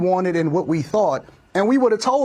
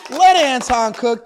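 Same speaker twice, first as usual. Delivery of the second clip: hyped self-cheer; 7 semitones higher than usual. Real, not acted. Hyping himself up.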